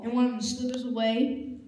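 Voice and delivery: usual speaking voice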